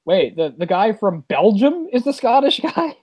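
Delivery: slow, high-pitched bafflement; mock incredulity. Podcaster baffled that the Belgian plays the Scot, mock incredulous. Mock disbelief at Highlander's casting.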